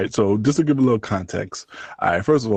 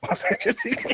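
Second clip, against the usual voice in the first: laughing; 13 semitones higher, 7 dB quieter, faster than usual. Real, not acted. Repeats boast laughing.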